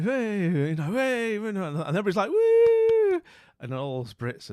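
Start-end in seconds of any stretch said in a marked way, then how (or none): 0.0-1.3 hyped-up presenter. Retold or imitated. Imitates hyped CEO coming on stage. 2.3-3.2 high, loud drawn-out "woo"; shrieky mock cheer; cheering whoop. Imitates cheering American audience.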